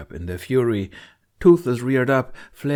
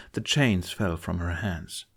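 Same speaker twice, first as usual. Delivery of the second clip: ordinary delivery, nothing marked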